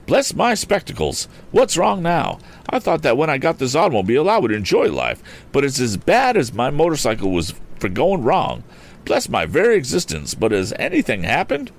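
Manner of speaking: high-pitched, flustered eccentric gentleman; Mr. Damon exclaiming. A narrator's reading. Eccentric motorist fussing over his broken-down automobile, exclaiming. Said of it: "The voice exclaimed".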